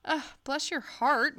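ordinary talk

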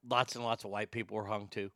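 tossed off under the breath, fast; quiet aside. Real, not acted. Muttered aside, then repeated emphatically.

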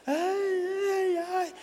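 high, tearful mock fan cry; hammed-up high fan wail; mock fan screams. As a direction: imitate fans wailing for their idol. Imitating swooning fans.

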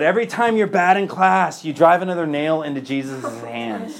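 high, loud mock teacher scolding; hammed-up strict teacher laying on guilt; stern teacher. Teacher scolding bad students, mock stern. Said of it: "And she said".

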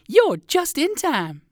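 very high, slow chirpy voice; bubbly little chick; excited chick girl. Acted. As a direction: chick girl tells the rabbit he is in time, very high. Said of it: "she exclaimed".

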